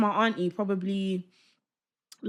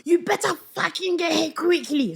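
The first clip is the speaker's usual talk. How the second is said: raised, higher-pitched mock angry dad; angry swearing; 9 semitones higher, 4 dB louder than usual. Dad swearing at the ambulance on the phone, mock angry. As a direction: imitate a dad cursing down the phone. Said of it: "My dad's on the phone swearing".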